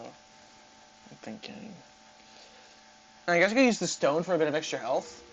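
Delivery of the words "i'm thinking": breathy whisper to self. Real, not acted. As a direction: murmur it under your breath. Thinking aloud, whispered to self.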